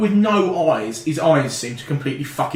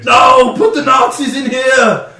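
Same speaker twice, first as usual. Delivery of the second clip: put-on slow, loud, high Austrian-accented shout; Arnie; 8 semitones higher, 7 dB louder, slower than usual. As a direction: bellow it like an action star with a thick accent. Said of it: "ends up sounding like fucking Arnie".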